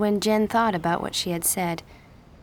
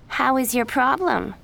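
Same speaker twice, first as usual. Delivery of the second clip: higher, louder fake sympathy; mock concern; 6 semitones higher, 4 dB louder than usual. A narrator's reading. Miranda taunts Jen about rumors, sugary and loud. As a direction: needle her with sugary concern.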